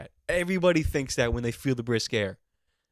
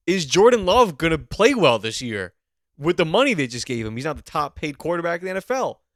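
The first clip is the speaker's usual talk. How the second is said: loud, higher-pitched demand; worked-up fan, voice raised and pitched up; urgent sports rant; 4 semitones higher, 8 dB louder than usual. Acted. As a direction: rant as if football outranks everything. Mock-outraged rant on what really matters.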